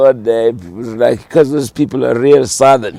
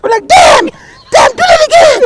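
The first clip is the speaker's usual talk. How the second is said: much higher, louder delighted cries; amazed shout; 9 dB louder than usual. Retold or imitated. Relatives at the table shouting for more, much higher and louder.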